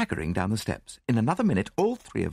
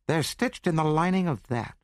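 higher-pitched woman giving up a secret; woman's voice; 8 semitones higher than usual. A narrator's reading. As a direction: reveal it in a high female voice. Woman gives up where they are hidden.

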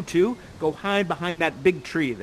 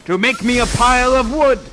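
loud, raised theatrical verdict; grand pronouncement; 5 semitones higher, 9 dB louder than usual. Acted. Prospero sentencing the traitor, booming proclamation. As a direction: proclaim the sentence at full volume.

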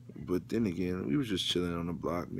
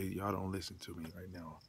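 ordinary talk, nothing marked

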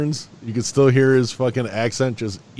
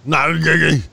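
loud, higher-pitched garbled grunts; mock movie grunting; 5 semitones higher, 6 dB louder, much slower than usual. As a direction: blurt it out as a loud caricature. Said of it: "The only thing he said was".

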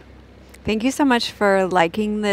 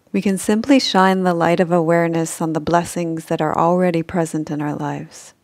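guided meditation; 3 dB louder than usual. Acted. Guide leading listeners through a gratitude sleep meditation, calm and measured. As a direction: guide them toward sleep in even, unhurried phrases.

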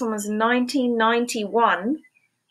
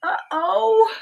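high, sing-song uh-oh; a cartoonish oops for the kids; playful uh-oh; 12 semitones higher, 3 dB louder than usual. Refrain voiced by book character.

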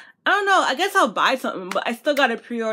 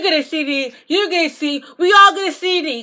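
excited shout; 4 semitones higher, 4 dB louder than usual. Real, not acted. Hyping fans to buy CDs.

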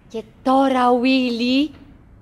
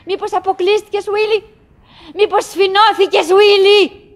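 higher, louder worried call across the distance; anxious call; 10 semitones higher, 7 dB louder than usual. Acted. Wife calls out to her unseen husband, anxious and loud. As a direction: call out to him, fearing he's hurt.

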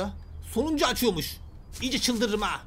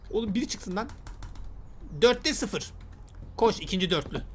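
usual speaking voice

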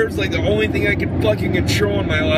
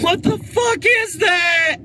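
shocked shout; 10 semitones higher, slower than usual. Real, not acted. Man seeing his ruined haircut, shocked high shout. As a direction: yell it out in horror at the mirror.

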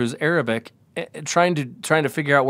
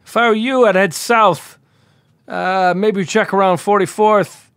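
offhand cop; 5 semitones higher, 4 dB louder than usual. Retold or imitated. Cop voiced giving advice.

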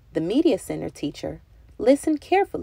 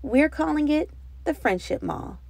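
proudly; 8 semitones higher than usual. A narrator's reading. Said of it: "Thomas said proudly".

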